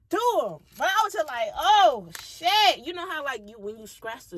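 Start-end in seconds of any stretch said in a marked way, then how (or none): 1.6-2.8 high, loud startled curse; surprised exclamation. Retold or imitated. Narrator quoting her startled reaction. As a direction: blurt it out startled.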